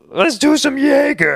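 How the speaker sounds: put-on rowdy drunk fan. Maniac fan after the show.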